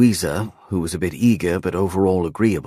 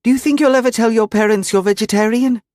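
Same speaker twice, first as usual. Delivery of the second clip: put-on high girl's voice, fast, blurting; blurted girlish voice; 6 dB louder, faster than usual. A narrator's reading. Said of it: "she blurted out".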